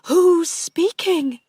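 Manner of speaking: high, loud, alarmed question; frightened. A narrator's reading. Aunt calling to an unknown voice, frightened. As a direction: demand it in alarm, pitched right up.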